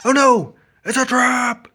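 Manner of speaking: high, loud sudden alarm; alarmed cry. Acted. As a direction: shout it out as a shocked trick.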